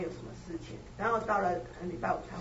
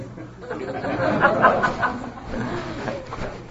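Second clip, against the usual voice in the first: loud, breathy burst of laughter; big laugh; 5 semitones higher, 12 dB louder than usual. Real, not acted. Recalling meeting the famous teacher, a loud real laugh. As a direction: laugh out loud, falling away.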